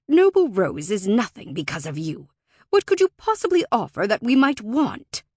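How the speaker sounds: loud, scowling contempt; scornful scoff. A narrator's reading. Deacon rejects Alora's offered debt, scornfully scowling. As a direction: scoff at a worthless offer, rising in pitch. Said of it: "A scoff tore from Deacon's lips. He scowled".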